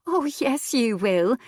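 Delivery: high teasing female voice; playful wife. A narrator's reading. Wife teases husband into going, high playful voice. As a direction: sweetly insist he's going. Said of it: "Ellen responded".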